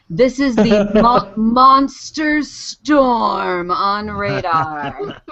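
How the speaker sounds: slow, drawn-out monster-movie title read; mock-dramatic announcement. Reading a listener's radar picture title, mock-dramatic announcement. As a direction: stretch each word out for comic menace.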